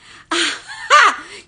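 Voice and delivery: high, loud burst of delighted laughter. Real, not acted. Greeting a viewer named King Charles with a loud delighted laugh.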